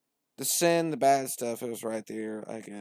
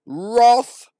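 very high, much louder, slow booming voice; God from the clouds; 13 semitones higher, 19 dB louder, much slower than usual. Acted. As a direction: boom it down from the sky. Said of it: "coming down out the clouds, like".

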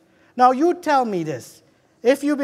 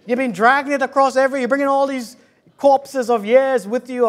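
ordinary delivery

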